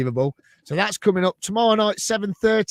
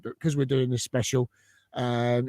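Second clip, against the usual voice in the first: ordinary delivery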